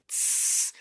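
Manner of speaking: long unvoiced hiss through teeth. Shown as a demonstration. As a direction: let air out on a steady S. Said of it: "make an S".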